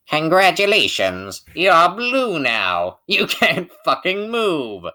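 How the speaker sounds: high, loud, drawn-out mock congratulations; mock announcer. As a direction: announce it like a gleeful game taunt, stretching words out. Mocking game attack announcement as a joke.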